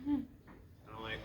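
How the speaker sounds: normal talking voice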